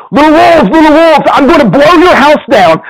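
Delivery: sing-song menace at the little wolves; big bad pig.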